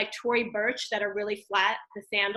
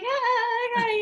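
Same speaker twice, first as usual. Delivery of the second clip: high, strained cheery reply; fake-bright greeting while caught looking a mess; high forced cheerful hey; awkward chipper hello; 12 semitones higher, 3 dB louder than usual. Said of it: "I'm like".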